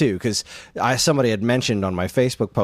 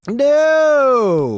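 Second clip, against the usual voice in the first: loud, much higher, drawn-out no; emphatic no; 13 semitones higher, 6 dB louder than usual. Real, not acted. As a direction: refuse it outright, laughing at the idea. Emphatic refusal, saw Suicide Squad?.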